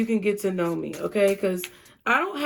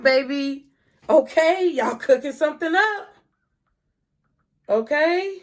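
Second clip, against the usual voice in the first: much higher, louder, cheering them on; excited hype; hyped cheering; 7 semitones higher, 3 dB louder than usual. Real, not acted. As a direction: hype up the Pisces viewers.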